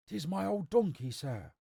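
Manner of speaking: put-on high-pitched rustic old man; an anxious old villager pleading his case; old man explaining. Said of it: "he explained".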